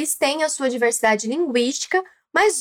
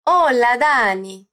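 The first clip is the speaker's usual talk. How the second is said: slow, drawn-out "Hola, Dani", falling at the end; singsong intonation; 4 semitones lower, slower than usual. Shown as a demonstration. Teacher getting learners to copy Belén, slow singsong intonation. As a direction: stretch Hola, Dani in an Argentine lilt. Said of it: "Tenta falar dessa forma comigo".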